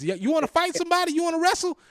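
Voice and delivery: high, fast taunting challenge; mock challenge. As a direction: dare the bully to fight.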